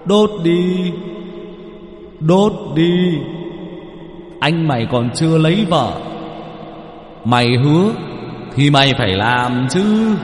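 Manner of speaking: high, sing-song haunting call; a ghost nagging a promise; echoing ghost voice. Acted. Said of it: "cứ vang lên văng vẳng". (giọng ma văng vẳng, ngân nga)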